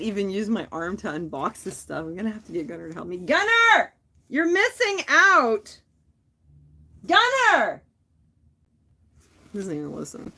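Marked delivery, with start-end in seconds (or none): 3.2-3.9 loud high call across the house. Real, not acted. Calling son from another room. 4.3-5.8 loud, slow, high call. Real, not acted. Calling son from another room. 7.1-7.8 loud high call again. Real, not acted. Calling son again.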